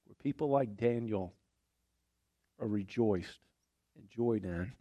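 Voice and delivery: quiet, tearful thanks, holding back crying; real emotion catching softly in the voice; choked up. Real, not acted. Preacher thanking the congregation for welcoming Daniel, choked up. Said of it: "I can tell I'm crying".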